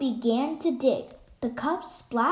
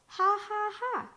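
high cackling gull laugh; 8 semitones higher than usual. A narrator's reading. Gulls over the island laughing high and bright. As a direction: cackle like gulls wheeling overhead. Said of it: "cried the laughing gulls".

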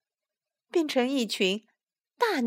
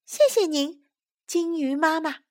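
put-on high, polite child voice; small creature's high voice; little tadpoles; 6 semitones higher than usual. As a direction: thank her as a little tadpole. Tadpoles thank the big fish.

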